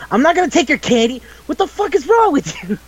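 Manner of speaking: high-pitched incredulous scolding; hammed-up girl losing patience; mock exasperation. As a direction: protest way up high, acting it out. Retold girl scolding growling friends.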